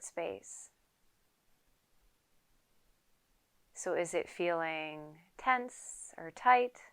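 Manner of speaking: unhurried yoga teacher leading a quiet meditation; guided meditation. Acted. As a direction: calmly guide them into stillness, leaving space to breathe. Leads a seated guided meditation.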